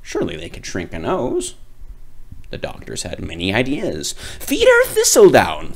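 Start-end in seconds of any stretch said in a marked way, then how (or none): 4.4-5.7 high, louder doctor voice; a know-it-all doctor offering a cure; high, pompous physician; fussy doctor. Acted. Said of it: "said one doctor".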